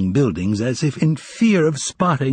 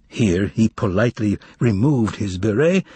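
ordinary delivery

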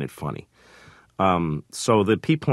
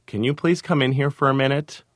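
imitating a wife calling; 7 semitones higher than usual. Wife calling from the other room.

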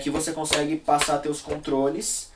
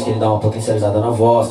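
usual speaking voice

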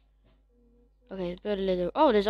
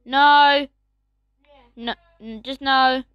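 loud, stern no; firm no; 9 dB louder, slower than usual. Real, not acted. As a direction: tell the dog off. Scolding the dog.

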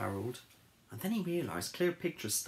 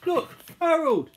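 high, loud exclamation; excited calling; 9 dB louder than usual. Acted. Pig Sticks pointing out the New York statue, loud excitement. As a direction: call out to a friend.

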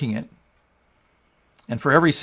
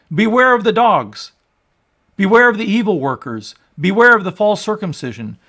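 loud, higher-pitched warning read like a sermon cry; forceful preaching; 6 semitones higher, 8 dB louder than usual. Acted. Preacher warning the congregation against the Judaizers, forceful preaching. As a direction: thunder the warnings from the pulpit.

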